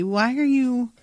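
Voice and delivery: ordinary talk, nothing marked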